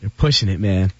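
low warning. Real, not acted. Threatening his cohost, low menacing warning.

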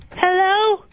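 put-on a middle-aged Londoner's British accent, a bit brash. British-accented ex ringing the radio show to scold Tommy. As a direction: play a cheeky London ex-girlfriend in British accent.

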